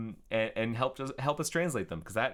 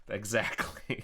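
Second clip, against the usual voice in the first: ordinary talk, nothing marked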